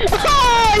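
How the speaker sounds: long, high "oh" sliding down; high-pitched cry. Real, not acted. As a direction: wail out high, then let it fall. Outcry during game action.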